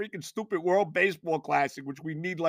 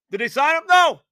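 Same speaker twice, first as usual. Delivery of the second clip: rising panicked yell, much louder; panicked shouting; 8 semitones higher, 9 dB louder than usual. Retold or imitated. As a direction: bark out the questions in alarm. Retold panicked exchange with wife.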